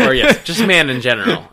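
laughing loudly while talking. Real, not acted. Laughing at own joke.

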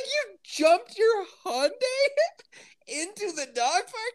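imitating a stunned man, laughing through it; incredulous, laughing. Guy incredulous, retold while laughing.

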